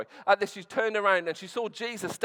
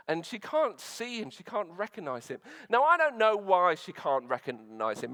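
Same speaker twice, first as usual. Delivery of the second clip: ordinary talk, nothing marked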